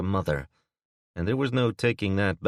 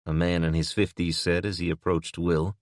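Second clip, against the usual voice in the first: normal talking voice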